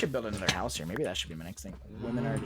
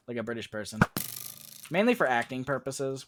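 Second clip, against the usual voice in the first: usual speaking voice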